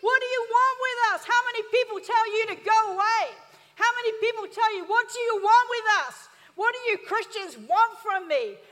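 high, loud demonic shouting; demon crying out. Acted. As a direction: roar it out like a tormented demon. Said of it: "He cried out at the top of his voice".

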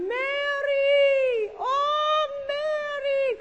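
high, loud drawn-out wail; hammed-up anguished call; imitated crying out. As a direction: cry out a long pleading wail, imitating her. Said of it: "crying and rocking and going".

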